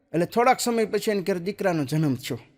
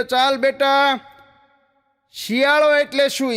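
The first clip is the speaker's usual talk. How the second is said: stern teacher; 6 semitones higher, 4 dB louder, slower than usual. Acted. Teacher quizzing student, raised voice.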